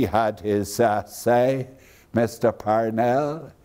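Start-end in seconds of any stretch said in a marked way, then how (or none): none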